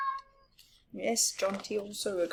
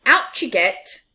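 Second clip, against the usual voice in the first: louder, higher brisk command; firm order; 9 semitones higher, 8 dB louder than usual. Acted. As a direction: order him out of the barrow. Big Mouse orders him out.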